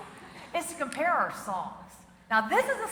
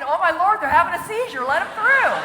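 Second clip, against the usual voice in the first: mock alarmed driver; 4 semitones higher, 10 dB louder than usual. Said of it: "the car next to me".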